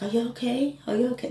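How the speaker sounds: put-on high, worried child voice; concerned kids. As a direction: mimic the kids asking anxiously. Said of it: "they were so concerned".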